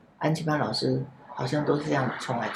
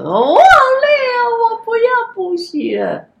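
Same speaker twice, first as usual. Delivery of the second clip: much higher, louder, slow sobbing wail; crying child; 10 dB louder, slower than usual. Retold or imitated. The exhausted boy begs to quit tutoring, high slow sobbing wail. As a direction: wail it like a worn-out child begging to stop. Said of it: "他就哭好的叫了".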